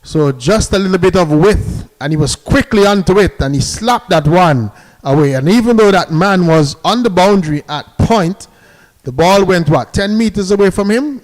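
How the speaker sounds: thrilled play-by-play after a four; excited commentary. Real, not acted. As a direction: call the boundary with real excitement. Four slashed to the boundary.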